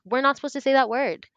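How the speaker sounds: put-on high, loud and fast, a startled kid protesting; shocked child. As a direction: blurt it out like a shocked fifth grader. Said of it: "And I was like".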